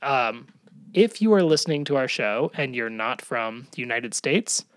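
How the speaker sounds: ordinary talk, nothing marked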